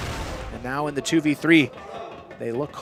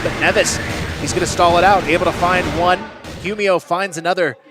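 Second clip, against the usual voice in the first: hyped shouting play-by-play, higher; esports caster; 4 semitones higher, 7 dB louder than usual. Real, not acted. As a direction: yell the kills as they land. Live play-by-play of a multi-kill.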